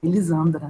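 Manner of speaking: Elisandra with the s voiced as Z; "Elisandra" pronounced like Elizandra; Z pronunciation. Shown as a demonstration. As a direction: say Elisandra with a "z" sound in the middle. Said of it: "com som de Z".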